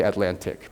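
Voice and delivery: "Atlantic" with a clear T before -ic; unflapped T. Shown as a demonstration. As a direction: say "Atlantic" with the second T fully sounded. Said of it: "Not everybody does".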